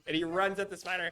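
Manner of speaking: usual speaking voice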